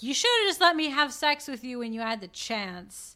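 mock accusation. Cypher blaming Trinity, acted quote.

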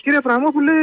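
high, pointed reporter's question; mock journalist. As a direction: put the question like a pushy reporter. Caller voices journalist.

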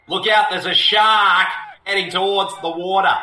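hammed-up Boston accent; mock Bostonian warning. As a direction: call it out in a broad Boston accent. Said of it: "if this was set in Boston".

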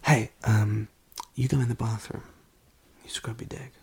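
very soft, halting role-play; hushed confrontation. Acted. Telling a man to go in the bathroom, hushed. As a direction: break it to him quietly.

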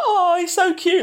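very high-pitched mock gushing; gushing cooing. Woman at the market melting over Pikachu, high cooing. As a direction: coo over something adorable in a high imitated voice.

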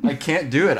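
giving up on the accent with a squeak; exasperated laughing protest. Real, not acted. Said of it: "can't do a New England accent".

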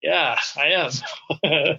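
mock-embarrassed slow reply; sheepish admission. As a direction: own up awkwardly, stretching the words out. Sheepish retold admission to hosts.

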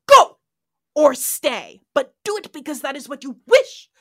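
high, loud, menacing commanding voice; terrifying ultimatum. Retold or imitated. Khan giving Marla an ultimatum, loud and terrifying. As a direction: loom over her and bark the choice. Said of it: "he sounds terrifying".

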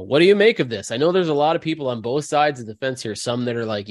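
ordinary talk

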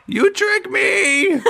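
very high, slow goblin wail; outraged goblin. Acted. Goblin accuses the captors who fooled him, high wailing outrage. Goblin realises he was tricked.

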